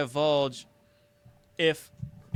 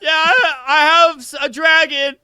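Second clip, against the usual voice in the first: much higher, loud, cracking voice; mock bluff; 13 semitones higher, 14 dB louder than usual. Joking about scaring a robber, high strained bluff. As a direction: bluff wildly with a straining squeak. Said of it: "You'd be like".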